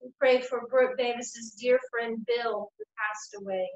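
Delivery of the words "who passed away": ordinary talk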